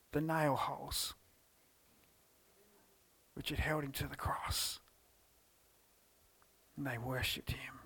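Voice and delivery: soft whispered awe; reverent whisper. Acted. Preacher whispering how the women worshipped at his feet. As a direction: hush it like kneeling in worship.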